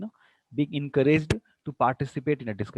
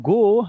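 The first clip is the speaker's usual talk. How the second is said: "go" said high and loud, rising; loud, lifted "go"; 6 semitones higher, 11 dB louder than usual. Shown as a demonstration. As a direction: push "go" sharply upward. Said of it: "there is a rise".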